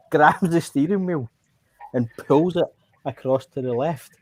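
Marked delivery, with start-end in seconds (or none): none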